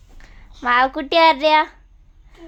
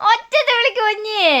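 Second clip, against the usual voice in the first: urging call; 8 semitones higher than usual. Real, not acted. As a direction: urge the little one in a raised pitch. Urging baby to call loudly.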